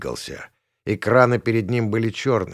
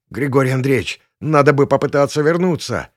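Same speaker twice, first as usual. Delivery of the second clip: high, loud urgent plea; croaked plea; 5 semitones higher, 5 dB louder than usual. A narrator's reading. Passenger pleading to turn back as they fall, croaked. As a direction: urge him with a touch of croak. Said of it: "прохрипел он".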